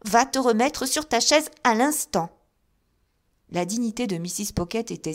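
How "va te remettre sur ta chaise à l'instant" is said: loud, high imperious order; mother sends her daughter back to her chair, imperious; stern command. A narrator's reading. Mother ordering Jane back to her chair, loud and imperious.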